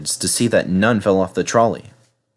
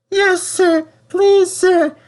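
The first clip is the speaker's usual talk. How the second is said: much higher, timid squeak; nervous Percy; 3 dB louder, slower than usual. Acted. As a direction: answer meekly, afraid of trouble. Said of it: "said Percy nervously".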